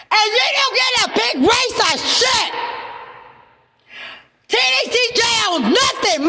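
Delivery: high, raw shouted outrage; furious screaming. Real, not acted.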